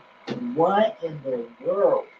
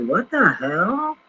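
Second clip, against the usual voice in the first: higher, slowed, drawn-out disbelief; shocked what the hell; 6 semitones higher, 4 dB louder, slower than usual. Retold or imitated. Reacting to disturbing info, drawn-out shocked disbelief. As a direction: stretch it out like you can't believe your eyes. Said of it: "I'm like".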